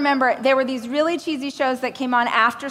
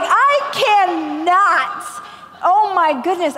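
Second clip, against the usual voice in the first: aghast; 6 semitones higher, 4 dB louder, much slower than usual. Retold or imitated. Shocked refusal quoted.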